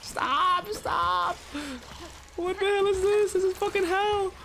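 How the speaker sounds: real high panic. Real, not acted. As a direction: beg it to stop in rising panic. Dragged into water, panicking.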